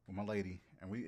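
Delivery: ordinary talk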